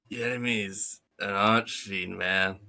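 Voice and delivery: low, laid-back surfer dude. Acted. Player voicing a game character, relaxed surfer dude. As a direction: say it like a mellow surfer. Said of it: "more of a surfer dude thing".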